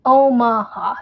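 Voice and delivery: "Omaha" in three clear syllables, oh-MAH-hah; careful pronunciation. Shown as a demonstration. Resident correcting the spelling for chat, careful "Omaha". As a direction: sound out the middle A of "Omaha" fully. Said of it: "It's got an A between the M and the H".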